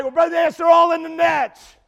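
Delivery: high, loud imitated protest; mock objection. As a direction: shout back at the preacher in mock defense. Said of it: "You're like".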